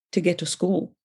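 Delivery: ordinary talk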